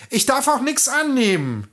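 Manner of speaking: put-on high girlish voice, cautious; young princess voice. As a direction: refuse politely through the window.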